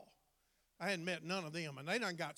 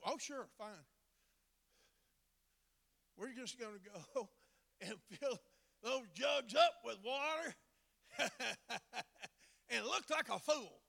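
mock servant; 4 semitones higher than usual. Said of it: "how many of y'all would go".

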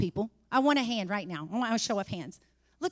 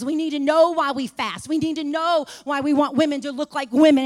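rising preacher's cadence, louder; preaching build; 3 semitones higher, 5 dB louder than usual. Acted. Preacher driving the congregation toward understanding, rising. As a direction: hammer each line harder, building.

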